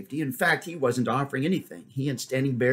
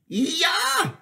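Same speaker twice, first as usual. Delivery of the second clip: sharp high yell; 4 dB louder than usual. A narrator's reading. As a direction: yell it to spur the horse. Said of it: "he yelled".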